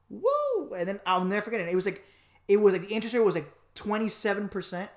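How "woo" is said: high, bright whoop of fun; a quick thrilled cheer; excited whoop. Real, not acted. Host whooping to guest before the story, excited.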